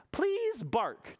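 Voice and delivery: high, polite storybook vet; pleading vet. Acted. Vet asking George to bark, high coaxing. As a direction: lift the voice to coax the patient. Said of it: "said the vet".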